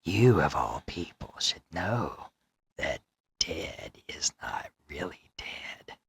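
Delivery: low and menacing, sinking into a whisper; creepy villain voice. Retold or imitated. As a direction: lean in like the masked killer, then whisper. Quoting the masked villain's line.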